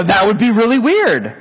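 ordinary talk, nothing marked

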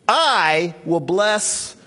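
"I" stretched long and punched, very slow; stress on I. Shown as a demonstration. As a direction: draw out the word "I" with force. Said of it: "emphasis was gonna be on the beginning".

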